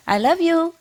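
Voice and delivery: high sing-to-the-pet voice; coaxing a pet bird. Retold or imitated. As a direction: coax the bird to talk, pitched high. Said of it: "And I say".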